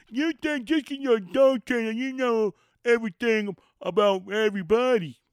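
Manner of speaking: high, loud sneering voice; mocking critic. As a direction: parrot a know-it-all heckler.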